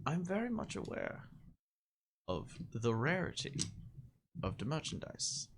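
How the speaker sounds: a hint of a snooty dealer; haughty negotiator. GM voices the negotiator NPC.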